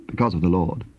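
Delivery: ordinary delivery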